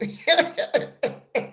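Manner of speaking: a light, pleased giggle at the compliment; shy giggle. Real, not acted. Host giggling at viewers calling her beautiful. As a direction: giggle it off, flattered.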